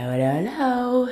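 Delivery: lilting high-pitched hello; high sing-song hello. Real, not acted. Sing-song mic check greeting.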